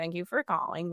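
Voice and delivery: low, soft, bored phone greeting; completely bored. Shown as a demonstration. Answering the phone as if you don't care at all, bored. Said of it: "as if you're completely bored".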